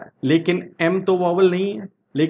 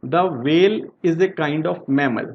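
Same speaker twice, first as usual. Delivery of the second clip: slow example read; much slower than usual. Shown as a demonstration. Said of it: "second example को देखें".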